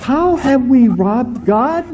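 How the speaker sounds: slow preacher's challenge to the flock; preaching rhetorical question. Real, not acted. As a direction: press the question on the congregation slowly.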